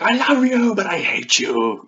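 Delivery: high, loud overwrought wail; hammed-up lover torn between love and hate; intense melodrama. Reader mocking a novel's intense lovers, overwrought. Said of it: "kind of, like, intense".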